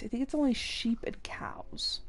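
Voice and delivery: unvoiced whispered aside. Real, not acted. Player whispering a game fact to herself, hushed. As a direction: breathe it out under your breath. Muttered aside while gaming.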